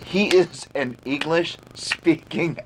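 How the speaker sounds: usual speaking voice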